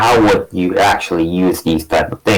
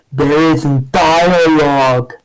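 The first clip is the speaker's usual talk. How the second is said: high, drawn-out mock exclamation; mock stormtrooper helmet line; 9 semitones higher, much slower than usual. Podcaster mocking the fight as a stormtrooper would, high and drawn-out. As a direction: stretch it out slowly, pitched well up. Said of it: "stormtrooper helmet would say".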